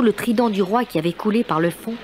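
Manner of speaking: normal talking voice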